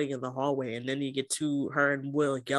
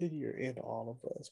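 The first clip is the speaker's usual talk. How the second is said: softly, like a suggestive hint; hushed innuendo; 10 dB quieter than usual. Retold or imitated. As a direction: drop the voice for the innuendo. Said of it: "Why would you say that to me".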